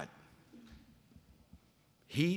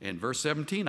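ordinary delivery

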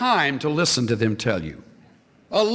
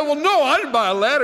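exasperated widow; 9 semitones higher, 5 dB louder, faster than usual. Retold or imitated. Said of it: "She said".

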